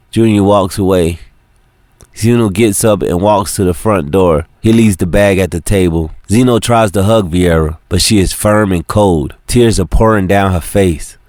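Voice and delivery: ordinary delivery, nothing marked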